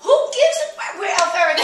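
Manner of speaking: the mom she'd love to snap back; exasperated outburst. Pretend. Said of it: "I wanna just go".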